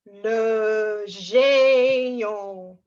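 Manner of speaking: huge, very slow booming giant; big lumbering drawn-out giant word. Pretend. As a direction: stretch each syllable out loud like a giant.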